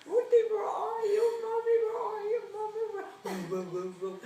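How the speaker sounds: high, loud, crying call for mommy; a frightened girl crying out for her mom; retold child's crying call. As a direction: replay the lost child sobbing for her mother. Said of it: "I was crying".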